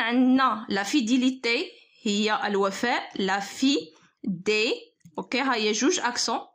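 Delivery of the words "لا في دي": لا في، دي in separate syllables with pauses; measured dictation, a gap before each syllable. Shown as a demonstration. Teacher writing out fidélité for students, broken into syllables.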